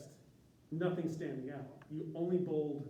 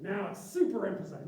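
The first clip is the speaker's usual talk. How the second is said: super emphasized said louder and higher; mock emphasis; 4 semitones higher, 7 dB louder than usual. Mocking an author who bolds everything, hammed-up loud.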